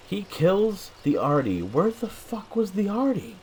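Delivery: high, incredulous squeal of a question; baffled disbelief. Real, not acted. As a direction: voice shoots way up in bafflement. Baffled by an unseen kill.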